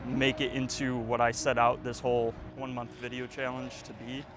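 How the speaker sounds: ordinary delivery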